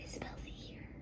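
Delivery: whispered quote. Retold or imitated. Voicing the toddler's greeting.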